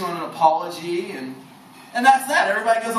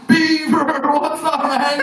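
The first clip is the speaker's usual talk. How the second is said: loud bar greeting; 6 semitones higher, 4 dB louder, much slower than usual. Pretend. Stranger greets him loudly at bar.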